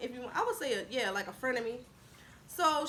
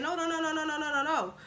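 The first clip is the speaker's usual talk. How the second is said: rapid-fire string of higher-pitched noes; insistent stalling; 4 semitones higher than usual. Retold or imitated. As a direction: imitate a friend holding someone up. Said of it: "But Bree just kept saying".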